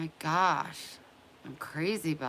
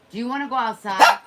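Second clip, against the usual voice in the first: louder, higher, fast and eager; excited dog-talk; 6 semitones higher, 15 dB louder, faster than usual. Real, not acted. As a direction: hype the dog up for outside.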